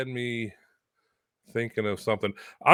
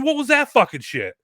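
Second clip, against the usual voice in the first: angry boss; 14 semitones higher, 6 dB louder than usual. Retold or imitated. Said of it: "He goes".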